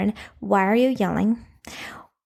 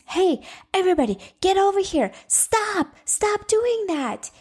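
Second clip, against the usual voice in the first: high raised calling voice, pitched way up; demo yell; 9 semitones higher than usual. Shown as a demonstration. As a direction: call the kids over and make them stop. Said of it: "yelling is just like raising your voice".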